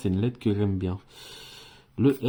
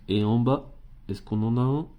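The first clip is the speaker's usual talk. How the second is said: ordinary talk, nothing marked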